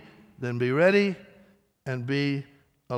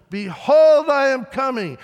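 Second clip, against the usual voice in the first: much higher and louder pulpit shout; emphatic shout; 9 semitones higher, 9 dB louder than usual. Retold or imitated. Preacher hitting the pulpit for emphasis, big shout. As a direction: slam it home with full force. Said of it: "he hits the pulpit, to emphasize".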